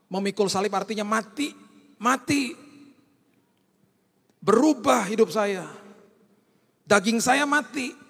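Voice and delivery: preaching at full force. Acted. Preacher urging the church that carrying the cross means dying, loud and high. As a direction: drive the point home to the congregation.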